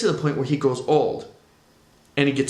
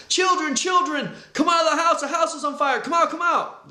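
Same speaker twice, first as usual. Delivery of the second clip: panicked father screaming; 13 semitones higher than usual. Retold or imitated. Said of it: "starts running in the house and screaming out".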